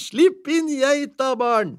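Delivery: high, giggling order; wife telling the children to let the goat in; laughing call. A narrator's reading.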